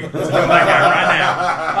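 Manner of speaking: loud, slow, through laughter; laughing agreement. Retold or imitated. Dad gleefully siding with his daughter, laughing loudly. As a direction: crack up while declaring it.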